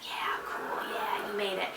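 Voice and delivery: whispered, voiceless; hushed fist-bump whisper. Retold or imitated. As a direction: breathe it like a secret cheer.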